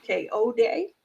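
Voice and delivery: "K O Day" said letter-like, ending in "day"; tentative pronunciation. Shown as a demonstration. Host trying out the guest's name, tentative pronunciation. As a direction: try "K O Day" tentatively, last syllable as "day". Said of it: "I may say it wrong".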